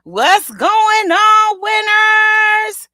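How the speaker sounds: high, loud, slow stretched hype call; big hype entrance; hype greeting shout. Acted. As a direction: blast the greeting to the crowd.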